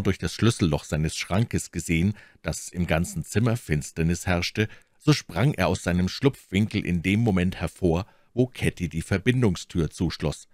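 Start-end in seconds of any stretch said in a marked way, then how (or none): none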